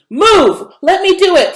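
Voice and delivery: high, loud impatient yell. A narrator's reading. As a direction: shove in and yell the order. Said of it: "Flossie yelled".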